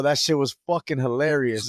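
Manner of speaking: ordinary talk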